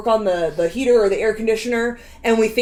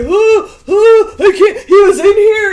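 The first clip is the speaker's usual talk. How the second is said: high, loud panicky rambling; panicked and half-laughing; freaking out; 8 semitones higher, 9 dB louder, slower than usual. Retold or imitated. Coworker freaking out, acted quote.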